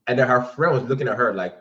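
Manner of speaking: ordinary talk, nothing marked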